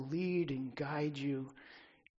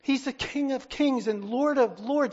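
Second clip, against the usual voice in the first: King of Kings and Lord of Lords boomed out loud; full-voiced preaching climax; loud proclamation; 8 semitones higher, 11 dB louder than usual. Real, not acted. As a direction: thunder the title.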